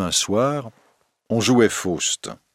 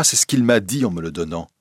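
ordinary delivery, nothing marked

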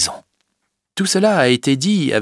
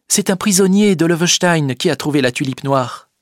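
put-on a young girl's raised, earnest voice; Rosa's voice; 8 semitones higher, 3 dB louder than usual. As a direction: plead her case firmly. Rosa explains.

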